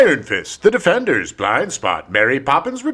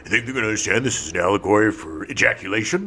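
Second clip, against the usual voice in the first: mock director voice; 6 semitones lower, 4 dB quieter, faster than usual.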